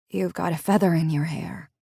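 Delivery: plain reading voice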